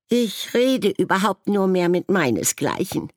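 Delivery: very high, loud huffy croak; furious croak. A narrator's reading. Pink-striped raven croaking furiously as it leaves. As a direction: storm off in a huff. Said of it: "krächzte einer und entfernte sich mit wütendem Flügelschlag".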